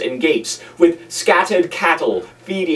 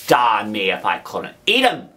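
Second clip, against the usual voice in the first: threatening convict; 6 semitones lower than usual. A narrator's reading. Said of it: "with a threatening shake of his head".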